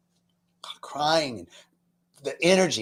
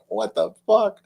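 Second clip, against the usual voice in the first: stunned; 7 semitones higher than usual. Retold or imitated. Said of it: "I'm like".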